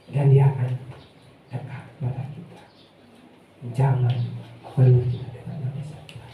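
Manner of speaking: low, near-whispered prayer voice; hushed blessing. Acted.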